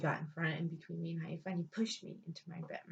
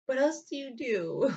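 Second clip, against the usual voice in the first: laughing; 7 semitones higher, 8 dB louder, slower than usual. Real, not acted. Laughing retelling.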